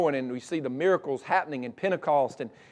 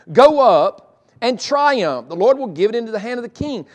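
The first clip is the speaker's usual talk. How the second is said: sarcastic; 5 semitones higher, 9 dB louder than usual. Acted. Prophet telling the king to go to battle, sarcastic. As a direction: overdo the triumph, loud and high. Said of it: "Because of his tone".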